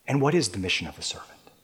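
quick breathy hushed question; whisper. Acted. Preacher asks the congregation in a fast whisper. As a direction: lean in and whisper it.

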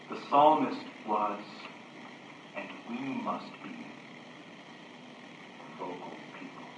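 sudden low, soft drop; hushed emphasis. Real, not acted. Preacher tells people they must be vocal, hushed emphasis. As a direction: let it sink quietly, with long pauses. Sudden hush after the climax.

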